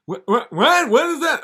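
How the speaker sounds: high, loud nervous comic voice; hammed-up high Seinfeld voice; squeaky Seinfeld. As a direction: yelp it like a startled Seinfeld. Seinfeld mishears devil.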